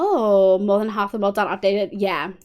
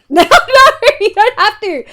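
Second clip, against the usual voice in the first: laughing protest; 11 semitones higher, 12 dB louder, slower than usual. Real, not acted. Laughing, stopping drowning.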